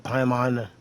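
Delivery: ordinary talk, nothing marked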